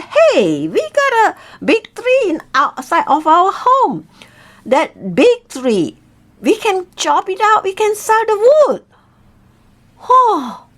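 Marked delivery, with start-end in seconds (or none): 0.0-8.8 higher, eager voice with a bright new plan; husband blurting out his plan to sell the tree, eager; excited idea. Acted. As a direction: pitch it up, thrilled by his idea.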